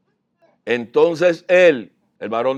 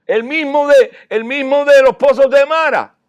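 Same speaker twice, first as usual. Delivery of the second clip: fiery preaching; 7 semitones higher, 6 dB louder than usual. Acted. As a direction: preach it out with full conviction. Preacher rises to full force. (predicación con fuerza y voz alzada)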